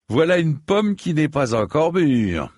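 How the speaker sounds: high, loud sneering knight's taunt; mocking elder knight. A narrator's reading. Elder knight mocks his beaten young cousin, in a high, loud sneer. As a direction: gloat over the beaten cousin, loudly. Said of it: "constata l'aîné".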